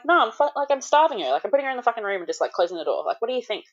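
high-pitched mock retort, speeding up; sarcastic indignation. Mum answers midwife's feeding question, sarcastic and indignant. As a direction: fire back with exaggerated sarcasm, pitched well up.

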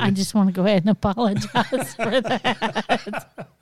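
slow, breaking into laughter; amused mock apology; laughing apology. Real, not acted. Laughing apology for bad poem.

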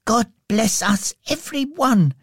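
put-on very high little-boy voice; Tiny Tim. A narrator's reading. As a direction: bless everyone in a small, high child's voice. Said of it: "concluded Tim".

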